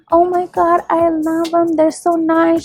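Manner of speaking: high-pitched imitation of a delighted client; mock gushing. Client gushing over her lashes.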